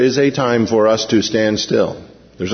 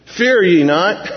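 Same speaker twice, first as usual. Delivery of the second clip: Moses; 6 semitones higher, slower than usual. Retold or imitated. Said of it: "Moses says unto them".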